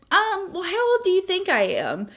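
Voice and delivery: high, sweetly coy question; mock-innocent high voice; coy teasing. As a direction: toy with him playfully. Playing games with drunk man.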